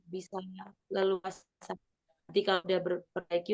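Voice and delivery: normal talking voice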